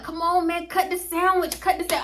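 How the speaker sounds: put-on high, eager whine at her boyfriend; impatient begging. Pushing her boyfriend to cut the sandwich, in a high, eager whine. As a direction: pitch it up, pushy and impatient. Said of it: "So I'm like".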